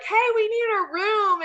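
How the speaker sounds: high, hammed-up sweet voice; hammed-up sweet innocent. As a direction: play the innocent couple, way over the top. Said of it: "we were totally hamming it up".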